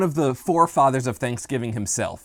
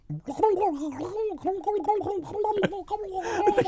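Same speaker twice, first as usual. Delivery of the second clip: turkey gobble impression; 5 dB quieter than usual. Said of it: "Your turkey has a speech impediment".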